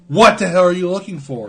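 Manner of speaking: retold bewildered yelling at a partner; exasperated. Acted retold exasperated question.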